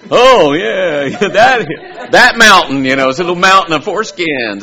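laughing loudly, voice jumping high. Real, not acted. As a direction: burst into laughter over the mountain. Laughing about hill of foreskins.

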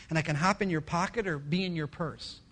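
usual speaking voice

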